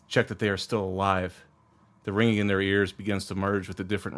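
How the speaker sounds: low, measured scene narration; story narration. A narrator's reading. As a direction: set the scene like a game master.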